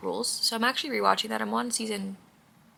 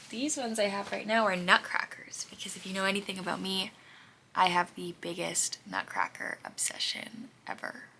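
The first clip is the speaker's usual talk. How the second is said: whispering; 9 dB quieter than usual. Real, not acted. Whispering while making the bed.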